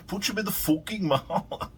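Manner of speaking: high, loud mock Irish, breaking into laughter; badly faked Irish accent, laughing; Conor McGregor. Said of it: "can't do a fucking Irish accent".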